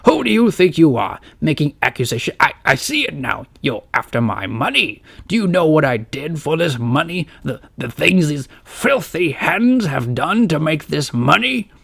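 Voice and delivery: put-on outraged old lord, building up; indignant uncle. A narrator's reading. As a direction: thunder at them in offended pride. Quincy outraged at accusation.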